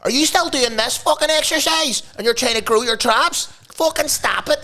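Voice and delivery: very high, put-on hectoring voice; mock bro trainer. Host imitating a personal trainer advising his mate, mock bro voice. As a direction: badger them like a cocky trainer. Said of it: "trying to get on like bros".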